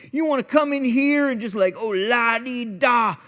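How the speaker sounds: loud, high sneering street preacher; yelled mockery. Box preacher taunting the man who wants prayer, yelling. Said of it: "He's yelling at people".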